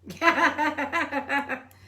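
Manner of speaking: high, loud real laugh bursting out; burst of laughter. Real, not acted. Cohost cracks up at the kickboxing tip, laughing loudly.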